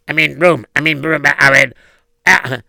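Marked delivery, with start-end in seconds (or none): none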